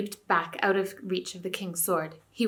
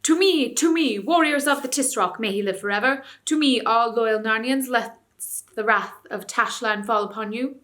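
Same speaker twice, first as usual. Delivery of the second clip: rallying call; 3 semitones higher, 6 dB louder than usual. A narrator's reading. Tarkan rallying warriors amid the crowd, loud call. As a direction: call the crowd to your side. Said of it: "stood calling out".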